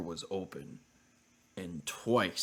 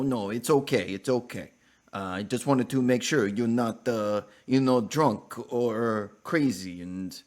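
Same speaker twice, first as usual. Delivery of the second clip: put-on lightly done Russian accent, a bit higher; 3 semitones higher, 6 dB louder than usual. Neighbor Olga calming down at the door, light Russian accent. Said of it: "she was like".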